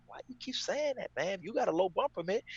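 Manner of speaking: why do you keep saying that; annoyed. Retold or imitated. Annoyed at repeating cellmate.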